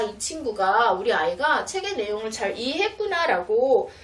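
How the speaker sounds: usual speaking voice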